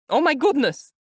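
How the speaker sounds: high, loud fretful mother's cry; high anxious mother crying out; anxious exclamation. A narrator's reading. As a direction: gasp it out like a nervous old mother. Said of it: "Pulcheria Alexandrovna exclaimed".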